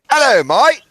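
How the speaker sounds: very high, squawky mock British voice; British airmen impression. Shown as a demonstration. Man telling a street-corner tale as British airmen, high silly British accent. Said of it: "from the two British airmen".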